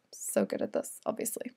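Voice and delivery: sigh, then whisper it with dry self-mockery; a whispered, sarcastic dig at yourself. Real, not acted. Host whispering after her clumsy rhyme, sarcastic and self-mocking.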